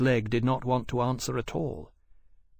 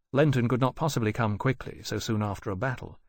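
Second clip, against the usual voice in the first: usual speaking voice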